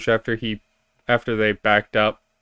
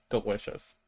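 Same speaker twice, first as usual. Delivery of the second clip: very soft in-character reply; hushed relish; 14 dB quieter than usual. Acted. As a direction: murmur it quietly. Repeats the creature's hushed answer.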